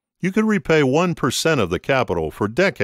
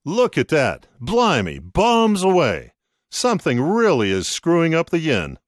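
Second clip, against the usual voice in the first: gleeful, higher cries at the screen; excited; 7 semitones higher than usual. Acted. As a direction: cheer the crash on. Jim excited watching the yen crash.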